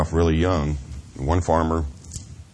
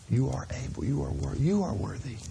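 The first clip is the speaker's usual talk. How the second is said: gentle calling; 6 semitones higher, 7 dB quieter than usual. Acted. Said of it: "He keeps calling us with that voice".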